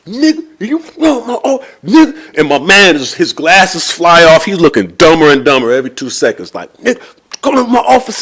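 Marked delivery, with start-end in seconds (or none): 0.1-2.4 high, strained, broken-up outbursts; acted choking struggle. Mortgage man throttling the seller, strained sputtering rage. 6.8-8.2 high, strained burst of words; acted throttling rage. Mortgage man choking the seller over his office, strained rage.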